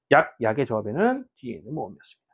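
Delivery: ordinary delivery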